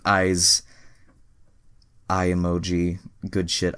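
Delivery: ordinary talk, nothing marked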